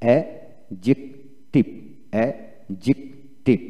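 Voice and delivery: অ্যাডজেক্টিভ slowly as "a... jik... tiv", no d; syllable by syllable. Shown as a demonstration. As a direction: break অ্যাডজেক্টিভ into three spaced syllables, d silent. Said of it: "লক্ষ্য করুন এখানে স্পেলিংটা কীভাবে হবে". (ভেঙে ভেঙে ধীরে অ্যাডজেক্টিভ, ডি ছাড়া)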